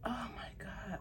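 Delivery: whispered oh my God; awed whisper. Real, not acted. Showing the gold clasp, whispering in awe. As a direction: breathe it in awe.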